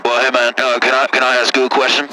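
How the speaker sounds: deep caller voice. Acted. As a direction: play a low-voiced country caller. Same caller voice.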